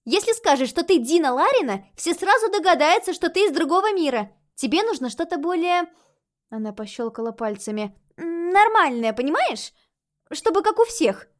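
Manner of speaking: put-on high, loud lively girl; child ghost voice. A narrator's reading. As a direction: explain it like an eager kid.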